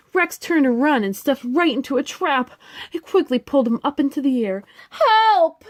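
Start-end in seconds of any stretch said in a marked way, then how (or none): 4.9-5.6 high, loud panicked call; terrified little dinosaur shriek; cry for help. Acted. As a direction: yell it like you're dangling from a trap. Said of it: "he cried".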